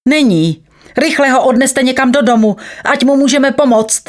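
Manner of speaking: high-pitched, pressing instructions; urgent orders. A narrator's reading. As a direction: order the bystanders to hurry. Doctor giving urgent orders. (naléhavé pokyny, výš posazeným hlasem)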